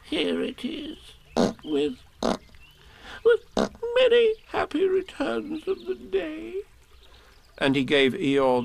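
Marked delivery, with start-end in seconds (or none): 0.0-6.6 very high, halting little squeak; Piglet, tearful. A narrator's reading. Piglet handing Eeyore the burst balloon, high and tearful. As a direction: struggle to get the wish out. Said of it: "sniffing a little".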